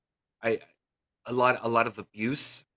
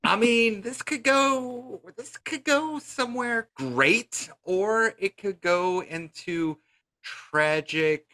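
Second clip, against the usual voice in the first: silly clown; 10 semitones higher, slower than usual. Acted. Clown guest mugging dramatically.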